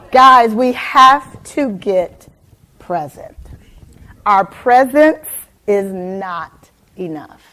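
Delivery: slow, loud, drawn-out point; preacher driving it home; slow emphatic message. Real, not acted. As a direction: lean in and land the lesson. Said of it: "core message slowed".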